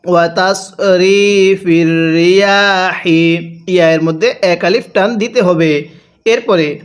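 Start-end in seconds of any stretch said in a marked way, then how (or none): 0.1-3.6 slow chanted ওয়া তাসরিফির রিয়াহি with a light breathy হি; tajweed recitation. Shown as a demonstration. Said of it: "তাহলে কিভাবে পড়ব?". (হালকা বাতাস দিয়ে তিলাওয়াতের সুরে)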